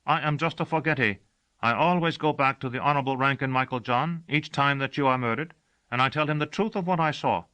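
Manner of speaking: matter-of-fact and a little sheepish; a forgetful servant explaining what he does each time; servant Gossagor's voice. Acted. Servant explains his role.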